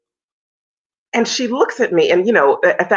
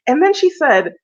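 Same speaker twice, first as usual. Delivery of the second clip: ordinary delivery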